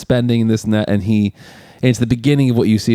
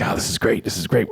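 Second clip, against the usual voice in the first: whispered glee, barely voiced; excited whisper; 6 dB quieter than usual. Bob whispering to Al that it's great, gleeful. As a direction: hiss the good news under your breath. Bob's excited aside retold.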